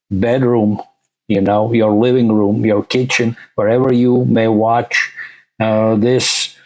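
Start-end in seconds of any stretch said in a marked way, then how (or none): none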